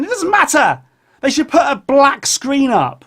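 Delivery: loud exasperated rant. Real, not acted. Ranting about video screens.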